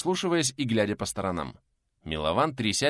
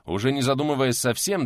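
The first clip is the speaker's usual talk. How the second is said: plain reading voice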